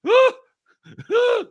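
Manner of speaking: high mock yelps "Ah! Ah!"; mock yell. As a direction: yelp like a startled man. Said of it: "Harrison Ford's just like".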